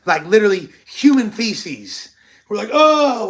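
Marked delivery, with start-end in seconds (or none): 2.7-3.3 much higher, loud recoil of disgust. Retold or imitated. Retelling finding the dirty purse, loud disgusted yelp. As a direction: yelp it like you just saw something gross.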